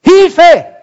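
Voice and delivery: very high, very loud cry of Hilfe; screaming for help. Retold or imitated. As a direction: yell out of the window for rescue. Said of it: "habe geschrien". (aus dem Fenster laut um Hilfe geschrien)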